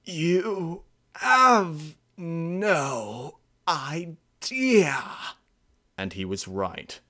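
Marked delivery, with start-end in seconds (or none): 0.0-5.0 put-on very slow, high, tearful old voice; the old pronghorn grieving, near sobbing; sad. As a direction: let it break into a cry. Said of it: "his eyes were just sad".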